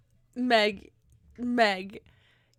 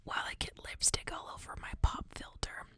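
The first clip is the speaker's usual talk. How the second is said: right in your ear; 11 semitones lower, 7 dB quieter than usual. Acted. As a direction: breathe it close to the mic. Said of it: "Can you hear me in your ear?".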